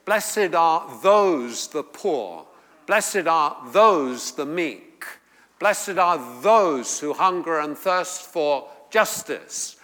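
slow chant with "those" stressed. Shown as a demonstration. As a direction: hit "those" in every line. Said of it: "why I've underlined those".

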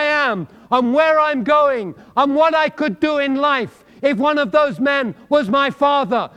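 high and loud, pressing the point; preaching at full force. Acted. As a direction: drive it home to the congregation.

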